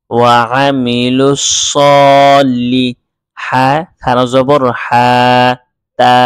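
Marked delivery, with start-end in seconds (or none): 0.1-2.9 slow, drawn-out chanted reading; Quranic recitation. Shown as a demonstration. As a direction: recite the words slowly and melodiously. Recites the joined word slowly. (ধীর সুরেলা কুরআন তিলাওয়াত)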